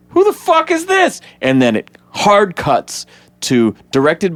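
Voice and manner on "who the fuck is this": much higher, hammed-up stunned yelp; shocked Barry. Barry seeing the stranger step out of the car, high stunned yelp. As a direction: squeak it out in total shock. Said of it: "and he goes".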